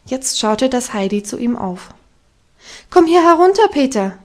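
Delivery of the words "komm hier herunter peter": hoch und laut hinaufgerufen; bestimmter Ruf. A narrator's reading. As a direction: befiehl es klar den Hang hinauf. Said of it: "rief es sehr bestimmt". (Heidi ruft Peter bestimmt herunter)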